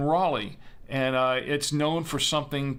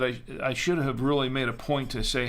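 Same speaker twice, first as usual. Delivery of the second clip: normal talking voice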